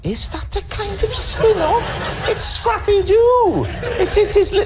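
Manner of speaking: a high, slowed cartoon-dog squeak; squeaky high voice. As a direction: squeak it out like Scrappy-Doo. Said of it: "look what you've done to my voice".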